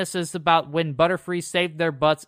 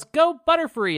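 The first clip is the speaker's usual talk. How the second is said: mock battle yell; 12 semitones higher than usual. Podcaster retelling Ash as he throws a Pokeball, high mock yell. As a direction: imitate a trainer's yell, pitched way up. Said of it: "He yells".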